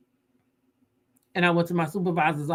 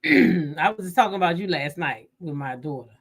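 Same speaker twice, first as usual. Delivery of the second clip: usual speaking voice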